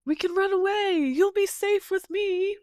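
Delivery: high, hammed-up sweet talk; smarmy suitor. Brandir making his move on Nienor, high mock sweet talk. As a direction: woo her with mock tenderness, pitched high. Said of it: "tries to make the move of".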